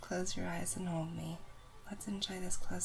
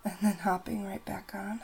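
ordinary talk, nothing marked

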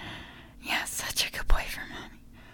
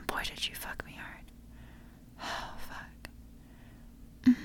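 intimate whisper; 6 dB louder than usual. Acted. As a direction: whisper tenderly, as if lying right beside him. Whispers to partner throughout.

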